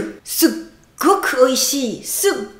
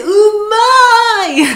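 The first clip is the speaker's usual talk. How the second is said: umai stretched very slow, higher and louder, almost sung; drawn-out umai; 7 semitones higher, 8 dB louder than usual. Shown as a demonstration. Praising something too good to bear, stretched and sung. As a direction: let umai ring out in pleasure. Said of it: "quand c'est trop bon".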